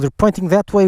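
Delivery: ordinary talk, nothing marked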